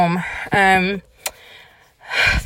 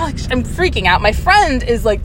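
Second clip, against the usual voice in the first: ordinary talk, nothing marked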